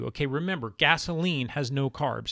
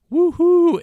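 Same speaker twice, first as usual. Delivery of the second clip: high, loud woohoo; hammed-up celebratory whoop; mock cheer; 14 semitones higher, 12 dB louder than usual. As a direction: whoop with exaggerated delight over nothing much. Mock cheer over the label's claim.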